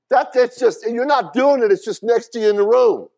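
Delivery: high, loud, ringing preacher's cry; full-throated sermon peak; preaching at full force. Acted. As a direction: preach it out hard to the congregation.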